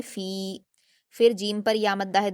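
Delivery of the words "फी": फी with the vowel held two beats; stretched madd. Shown as a demonstration. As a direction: softly draw out फी. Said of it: "दो हरकत खींचेंगे".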